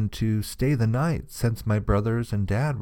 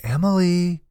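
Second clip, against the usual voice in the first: mom's call, pitched up; calling her name; 7 semitones higher than usual. A narrator's reading. Mom calls her daughter from elsewhere, calling out. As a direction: call out a name to someone in the house. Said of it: "my mom calling my name".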